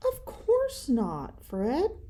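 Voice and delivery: put-on calm the upset child; soothing. Mama comforting a guilty Fred, soft slow soothing. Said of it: "soothed Mama".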